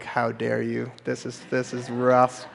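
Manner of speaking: low, soft grumble, slowed; mock-complain like a put-upon guy; hammed-up sulky husband; mock whiny guy.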